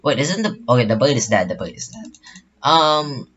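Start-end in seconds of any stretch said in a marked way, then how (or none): 1.5-2.1 whispered to self, deflated; whispered aside. Real, not acted. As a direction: breathe it out under your breath.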